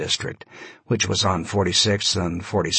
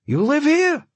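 put-on high, loud voice, light Yiddish accent; slight Yiddish accent; 4 dB louder than usual. A narrator's reading. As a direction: press him in a high Yiddish-tinged voice. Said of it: "slightly Yiddish accent".

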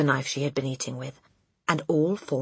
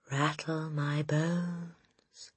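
slow, soft ghostly drawl; spooky voice; 8 dB quieter, much slower than usual. A narrator's reading. Parrot imitates a spooky voice, eerie and slow. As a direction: draw every word out like a spook. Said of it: "imitation of a spooky voice".

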